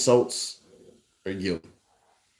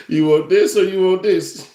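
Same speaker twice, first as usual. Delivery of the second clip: teasing laugh; 4 semitones higher, 4 dB louder than usual. Pretend. Teasing mock offer, laughing.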